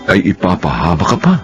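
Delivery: ordinary talk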